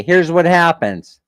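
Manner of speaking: usual speaking voice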